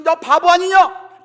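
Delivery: loud, high, drawn-out jeer; mocking taunt. Goliath to young David, loud mocking jeer. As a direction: taunt him slowly at full voice. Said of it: "조롱하는 거예요".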